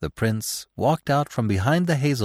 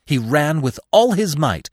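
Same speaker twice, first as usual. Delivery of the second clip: usual speaking voice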